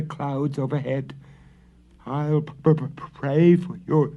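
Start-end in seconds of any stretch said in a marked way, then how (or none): 2.1-4.1 high, loud terrified stammer; stammering in fear. A narrator's reading. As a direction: stumble over each word in fright. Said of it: "he stammered".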